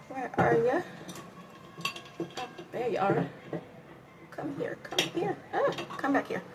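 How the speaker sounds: high, soft calling voice; little high-pitched summons; playful coaxing. Pretend. Playfully calling to a mislaid brush.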